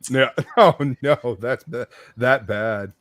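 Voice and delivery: ordinary talk, nothing marked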